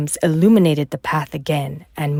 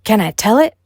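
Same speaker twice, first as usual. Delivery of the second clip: eager; 6 semitones higher, 6 dB louder than usual. A narrator's reading. Mushu eager to tell.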